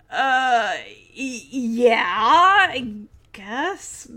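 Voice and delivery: hesitant, stretched-out agreement trailing off; acted reluctance. Stephanie answers whether Walter is a nice boy, reluctantly. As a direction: imitate squeezing out an awkward yes.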